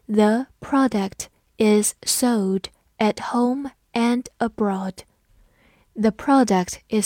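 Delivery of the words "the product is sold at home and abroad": word by word, slow and clear; slow reading. Shown as a demonstration. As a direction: read it slowly for learners. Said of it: "我们慢读一遍".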